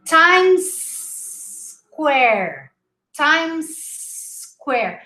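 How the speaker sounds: Times, long S, then quare; no extra syllable. Shown as a demonstration. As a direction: say Times Square split after a hissed S. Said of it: "pronounced this word like this".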